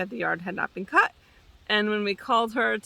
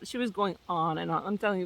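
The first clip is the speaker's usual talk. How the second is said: ordinary talk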